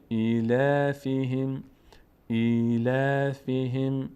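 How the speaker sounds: slow "ایلافهم" with thin, unrounded L and long vowels; light lam. Shown as a demonstration. Quran teacher showing an unthickened lam, slow recitation. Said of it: "لام پر نمیشه".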